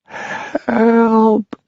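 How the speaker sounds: very high, distant wails for help; fading wail. Acted. Pipkin wailing as he is carried off, high and distant. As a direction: cry out as you are carried off. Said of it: "wailed a fading voice".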